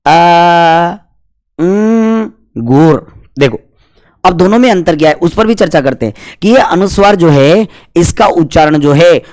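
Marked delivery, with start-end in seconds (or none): 0.1-3.2 अंगूर split into आ, उम, गुर with a hummed nasal उम; sounding out syllables. Shown as a demonstration. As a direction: stretch each piece, humming उम through the nose. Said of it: "अब उच्चारण करके देखते हैं". (अंगूर को आ, उम, गुर तोड़कर बोलना)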